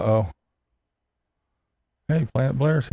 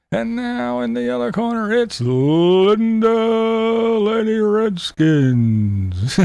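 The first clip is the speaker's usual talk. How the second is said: very slow, higher ring-announcer build-up; boxing ring announcer; 10 semitones higher, 3 dB louder, slower than usual. Shown as a demonstration. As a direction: boom it out like a boxer's entrance. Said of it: "say it like she's a, a boxer coming into the ring".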